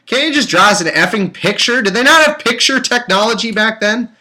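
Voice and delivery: loud, high, fed-up yelling at the book; exasperated shout. Real, not acted. Reader yelling at the Bible author, exasperated shout. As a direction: vent your frustration at the writer.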